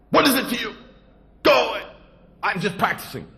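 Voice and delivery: high and loud, brushing him off; irritated shout. Acted. Guji angrily sending the stranger away. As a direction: snap at the intruder.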